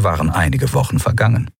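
ordinary delivery, nothing marked

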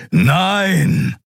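high, drawn-out angry denial; rumbling growl. A narrator's reading. Keru denies being in the cult, growling. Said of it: "grollte Keru".

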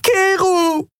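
very high, crying scream; weeping woman calling after him; tearful scream. A narrator's reading. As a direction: scream his name through tears. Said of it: "heiße Tränen über ihr Gesicht".

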